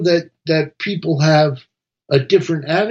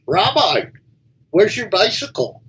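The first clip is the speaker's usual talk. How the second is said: a higher, lightly voiced priest asking; concerned priest, pitched up a little; priest in a joke; 7 semitones higher than usual. Priest calls to the rabbi, lightly voiced and higher.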